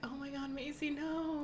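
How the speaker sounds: mock panic, begging her to stop; high-pitched retold alarm; alarmed plea. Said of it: "I really was like".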